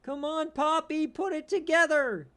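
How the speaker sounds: high, loud urging; exasperated high call rising to loud; pleading yell. Real, not acted. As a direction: beg the quarterback to wake up. Pleading with his quarterback.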